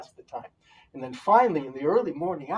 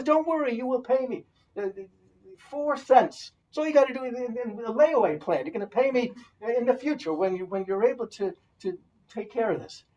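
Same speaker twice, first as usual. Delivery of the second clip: reassuring; 8 semitones higher, 6 dB louder than usual. Acted. Rabbi as woodsman reassures.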